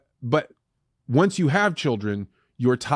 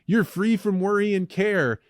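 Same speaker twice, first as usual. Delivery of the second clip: lightly voiced carefree retiree, pitched up; mock retirement commercial; 7 semitones higher than usual. Imitating old people in a financial planning commercial, mock carefree. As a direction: lift it high, a mild ad parody. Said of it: "old people running off a dock and jumping into the water".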